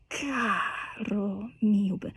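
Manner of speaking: soft and slow, carefully placed; soft pianissimo. Shown as a demonstration. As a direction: lay the words down gently and slowly. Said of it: "that pianissimo".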